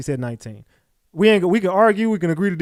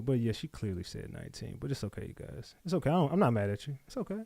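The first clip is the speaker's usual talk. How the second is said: soft reassuring; 4 semitones lower, 12 dB quieter, faster than usual. Real, not acted. Calming the chat after the argument.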